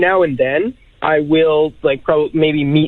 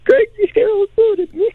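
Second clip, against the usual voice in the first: high squeal of excitement; freaking out. Real, not acted. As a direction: blurt it, starstruck and overwhelmed. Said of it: "I'm, like, freaking out".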